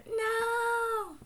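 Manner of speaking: a very high long gasp of shock. Acted. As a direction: cry out in disbelief. Leia held prisoner.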